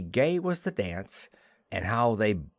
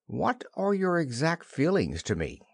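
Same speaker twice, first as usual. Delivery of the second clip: higher-pitched, attempted firmness; trying to be firm; 6 semitones higher than usual. A narrator's reading. Wendy questions Peter on his feelings, trying firmness. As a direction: ask it as firmly as you can manage. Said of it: "trying to speak firmly".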